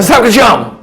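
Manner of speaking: loud, high yelled "I love you"; aggressive love declaration. Shown as a demonstration. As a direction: yell the love words like an attack. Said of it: "Que desgrama é esse de amor".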